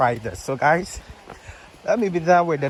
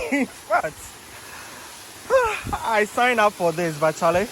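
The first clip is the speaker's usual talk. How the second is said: shaking with effort; 5 semitones higher than usual. Real, not acted. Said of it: "my legs are literally shaking".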